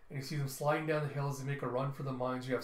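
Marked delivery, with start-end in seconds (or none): none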